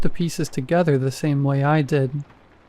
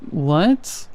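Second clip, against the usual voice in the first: a slightly higher what, dragged out long; high, drawn-out what; 2 semitones higher than usual. Acted. The fake husband calling from the kitchen, high and drawn out. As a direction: stretch what into two syllables, a little higher. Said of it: "a more high-pitched and drawn out".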